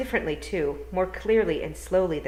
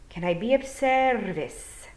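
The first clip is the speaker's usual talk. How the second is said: put-on slower, higher old Feagle; William the Gonagle; 6 semitones higher, 4 dB louder, slower than usual. William offering help to Tiffany, slow polite Scottish voice. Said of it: "said a voice".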